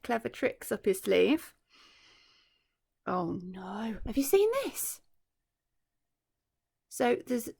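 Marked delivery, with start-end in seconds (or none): none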